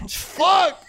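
ordinary delivery

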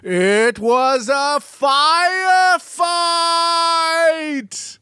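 high, drawn-out dramatic shout; hammed-up howl, stretching the last word; Willem Dafoe. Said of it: "drops to his knees and goes".

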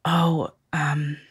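hesitant, hushed, drawn-out acted apology. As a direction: imitate a nurse breaking bad news in a slow near-whisper. Nurse breaking bad news apologetically.

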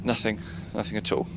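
low, quiet meek reply; subdued. Acted. Trainee answering angry boss after outburst, subdued. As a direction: answer meekly, voice dropped.

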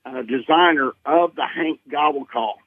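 usual speaking voice

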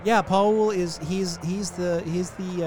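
lightly voiced stoned guide; a hint of a spaced-out stoner. GM voices Guy NPC.

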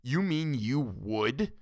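slow, stunned disbelief; appalled. Acted. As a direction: ask it as if you can't believe her.

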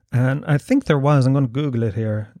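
normal talking voice

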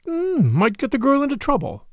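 high, knowing musing; sapient. A narrator's reading. Sister explains Ralph's silence, knowing and sage. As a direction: ponder it wisely, opening on a thoughtful hum. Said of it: "said Caroline sapiently".

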